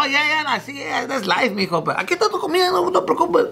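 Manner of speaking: high excited voice of the restaurant guy, Spanish. Retold or imitated. Cook greeting the stepson in Spanish, high excited mock voice. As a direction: play the surprised cook speaking Spanish, up high.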